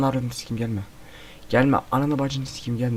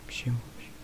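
soft unvoiced whisper; 15 dB quieter than usual. Real, not acted. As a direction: whisper it nervously. Hushed question about creepy figure.

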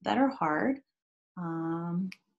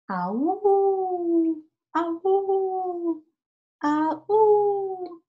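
high sing-song ah-ooh whoops, much higher; playful noisy whoops; 12 semitones higher, much slower than usual. Acted. Teacher acts out a noisy family for the kids, high sing-song whoops. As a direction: whoop like a rowdy family at play.

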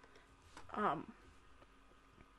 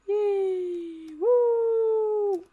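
high drawn-out cheer; 8 semitones higher, 4 dB louder than usual. Real, not acted. Celebrating in the game.

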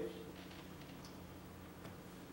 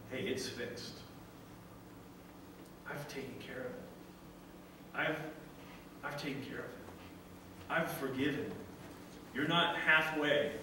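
reassuring father; 2 semitones lower, 6 dB quieter than usual. Retold or imitated. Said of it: "The father made sure that son knew".

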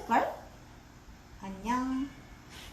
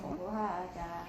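low, soft and slow; soft slow coaxing; 6 semitones lower, 8 dB quieter, slower than usual. Shown as a demonstration. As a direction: coax it quietly, drawn out. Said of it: "repeats the offer".

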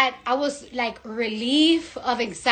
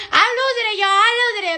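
losing it; 6 semitones higher, much faster than usual. Real, not acted. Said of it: "I'm losing my mind".

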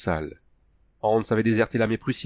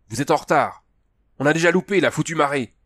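higher, louder gruff complaint; a rude captain scolding latecomers; 6 semitones higher, 5 dB louder than usual. A narrator's reading. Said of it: "Le ton de l'homme hérissa Hans". (plainte bourrue et plus forte)

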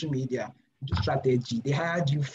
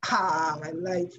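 a mock groan of despair, raised pitch; exasperated; 3 semitones higher, much slower than usual. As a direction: lament it half-jokingly. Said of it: "I'm like".

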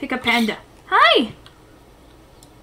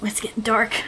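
whisper; 6 semitones lower, 7 dB quieter, slower than usual. Pretend. Mock ominous whisper.

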